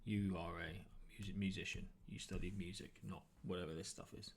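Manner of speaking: usual speaking voice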